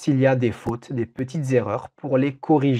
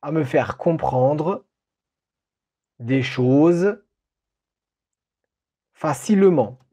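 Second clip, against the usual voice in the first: dictating. Shown as a demonstration. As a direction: read it out for a dictation. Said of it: "Je répète la phrase".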